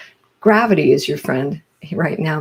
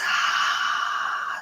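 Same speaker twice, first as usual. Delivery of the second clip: long audible sighing exhale; breathy open-mouthed sigh; demonstrated exhale; 5 dB quieter than usual. Shown as a demonstration. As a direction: let the breath out slowly through an open mouth. Models the long exhale for six.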